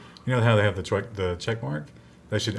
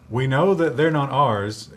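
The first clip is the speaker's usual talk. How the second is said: louder, higher, slow and deliberate; emphatic quote; 5 semitones higher, 6 dB louder, slower than usual. Retold or imitated. Quoting an interviewee's claim to dispute it, emphatic. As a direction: quote it pointedly, spacing it out.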